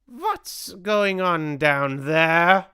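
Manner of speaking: slow, high, loud demand; Grand High Witch's shout. A narrator's reading. Grand High Witch calls down from the platform, slow loud shout. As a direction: shout it imperiously, stretching the words. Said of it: "shouted the Grand High Witch".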